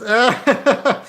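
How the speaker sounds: high cackle. Acted. Officer laughs in character.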